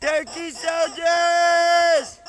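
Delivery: long drawn-out call, slow; calling from far away. Real, not acted. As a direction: holler it slowly across the yard. Calling the turkeys in.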